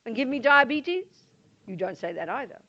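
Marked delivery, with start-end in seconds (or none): 0.1-1.3 loud, put-on voice, incredulous; mock-outraged question; indignant disbelief. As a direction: sound offended and disbelieving. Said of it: "And you do not say".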